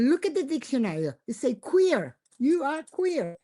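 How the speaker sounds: high, quick, triumphant insisting; insistent, high. Retold or imitated. Wife pointing her husband to the dictionary, high and insistent. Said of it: "And I said".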